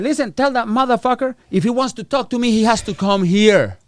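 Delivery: high, pushy defiance; defiant demand. Retold or imitated. Defiant message to ICE officer.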